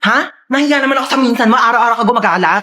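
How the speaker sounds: ordinary talk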